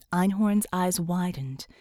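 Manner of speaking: usual speaking voice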